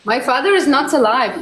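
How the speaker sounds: normal talking voice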